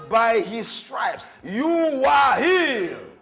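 slow, building to a shout on healed; preacher's slow proclamation rising to a shout; preaching scripture. Acted. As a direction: proclaim it slowly, then thunder healed.

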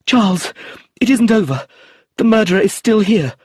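high, loud, frightened plea; desperate. A narrator's reading. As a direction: tell him the danger in panic. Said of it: "heard Sophia's voice say desperately".

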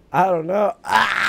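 high, loud screeching laugh; pterodactyl shriek. Real, not acted. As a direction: let out a shrill screech like a pterodactyl. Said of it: "Sound like a pterodactyl".

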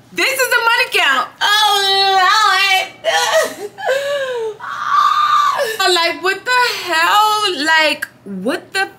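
high, slow wail of disbelief at the money; dismayed disbelief. Real, not acted. Dancer wailing in disbelief at her tiny night's earnings. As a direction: moan it out like you can't believe it.

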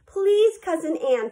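high, pleading plea to a cousin; begging. A narrator's reading. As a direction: plead sweetly for a favor. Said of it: "Fauna begged".

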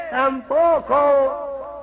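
agudo y muy lento; voz de personaje. Acted. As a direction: estirar la queja desde abajo. El de abajo. (el de abajo contesta, lento y agudo)

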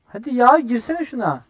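loud, high burst of impatience; exasperated urging. Real, not acted. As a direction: urge them on, fed up. Urges teammate to go in. (sabırsızca, yüksek sesle çıkışma)

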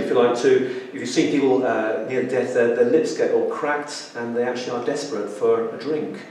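ordinary talk

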